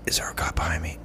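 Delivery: soft unvoiced whisper, secretive; whispered question. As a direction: whisper it so nobody behind hears. Asks buyer secretly about cop.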